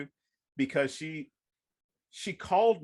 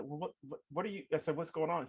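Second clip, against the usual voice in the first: softer, tentative asking; nervous quiet question; 7 dB quieter, faster than usual. Retold or imitated. Worried author asking mom quietly, tentative. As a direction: ask carefully, bracing for bad news.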